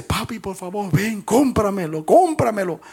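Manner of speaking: put-on a little boy begging his dad; child begging. Boy begging his father to buy back the boat, high pleading voice.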